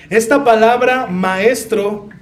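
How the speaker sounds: ordinary talk, nothing marked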